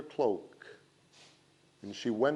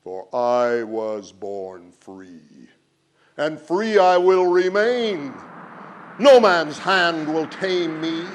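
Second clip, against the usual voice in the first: slow then louder proud boast; proud wind; 2 semitones higher, 11 dB louder, slower than usual. A narrator's reading. The wind boasts it was born free, proud and loud. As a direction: declare your freedom grandly. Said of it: "the wind replied".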